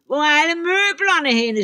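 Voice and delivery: high, loud troll shriek. A narrator's reading. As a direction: scream in shock at the empty room. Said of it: "skreg han".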